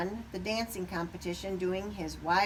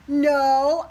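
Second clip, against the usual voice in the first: loud no; 7 semitones higher, 13 dB louder than usual. A narrator's reading. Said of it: "Cassie said".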